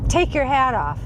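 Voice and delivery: retold demand. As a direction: imitate his abrupt order.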